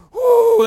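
high, very loud whoo; excited whoop. Real, not acted. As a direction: let out a preacher's whoop. Preacher's own excited whoop.

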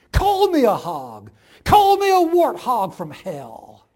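very loud, slow bitter yell; rolling out over the pasture. A narrator's reading. The farm wife hurls her final challenge at God, loudly. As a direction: let it carry across the fields. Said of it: "her voice rolled out over the pasture".

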